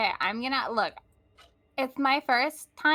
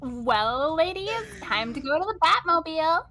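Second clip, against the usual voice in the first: Valley Girl playing superhero; rally the ladies as a Valley Girl crimefighter; Valley Girl Batman. Shown as a demonstration. Player performing on friend's request, Valley Girl Batman. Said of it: "Show me Valley Girl Batman".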